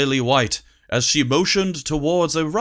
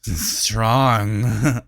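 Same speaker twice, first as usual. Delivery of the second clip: put-on drunk; 3 semitones lower than usual. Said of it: "he swayed".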